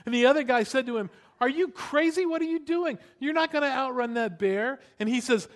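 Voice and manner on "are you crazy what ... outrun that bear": high-pitched, pressing disbelief; incredulous exclamation. Retold or imitated. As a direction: challenge a friend who has lost his mind. Retold friend reacts in alarm.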